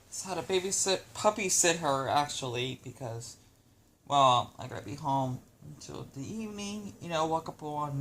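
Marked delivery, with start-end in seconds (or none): none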